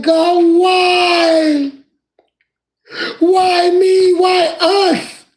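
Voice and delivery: high, loud anguished wail; slaves crying out. Retold or imitated. Enslaved people in the ship's hold crying to God, high, loud and anguished. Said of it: "cried out to God, saying".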